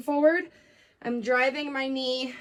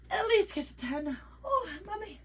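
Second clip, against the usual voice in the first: mock whimper; 6 semitones higher, 4 dB quieter than usual. Struggling through final reps.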